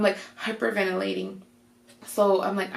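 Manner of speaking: normal talking voice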